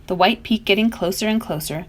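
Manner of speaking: ordinary delivery, nothing marked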